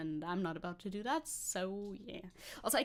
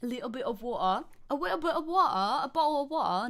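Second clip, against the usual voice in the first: louder, hammed-up British accent; mock British accent; 3 semitones higher, 5 dB louder than usual. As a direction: ham up a British glottal-stop accent. Repeats water in put-on accent.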